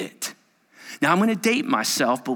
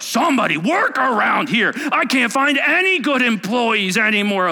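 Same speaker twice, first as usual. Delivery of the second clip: higher, louder fed-up complaint; mock a frustrated manager; exasperated boss; 9 semitones higher, 5 dB louder than usual. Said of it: "How about lazy people?".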